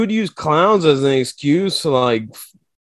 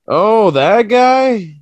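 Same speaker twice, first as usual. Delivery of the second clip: drawn-out, high "oh, that guy"; slow, lifted mock surprise; mock recognition; 6 semitones higher, 3 dB louder, much slower than usual. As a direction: act like you finally spot someone famous.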